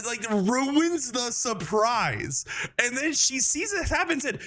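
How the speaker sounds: ordinary talk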